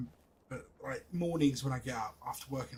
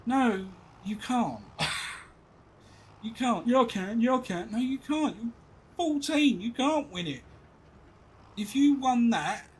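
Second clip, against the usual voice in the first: high, loud back-and-forth; dad and teen bickering over Fortnite; mock argument; 8 semitones higher, 8 dB louder, slower than usual. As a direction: play both sides of a row. Shouting match dad and son.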